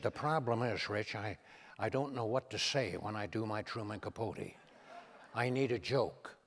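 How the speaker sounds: imitating Reagan, low and soft; hushed, folksy Reagan impression; Ronald Reagan. Reagan confiding to the comedian about his impressions, low and soft. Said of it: "He said".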